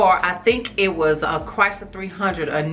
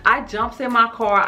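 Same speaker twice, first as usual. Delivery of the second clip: usual speaking voice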